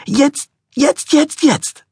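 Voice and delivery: very high, clipped staccato shrieks. A narrator's reading. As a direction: hammer each jetzt like a tantrum child. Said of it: "im Staccato-Rhythmus".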